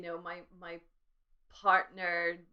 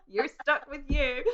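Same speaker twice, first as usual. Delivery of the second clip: slow, laughing through the words; giggling while saying it; 8 semitones higher, 13 dB louder than usual. Real, not acted. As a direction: laugh it out, drawn out. Laughing at shared joke.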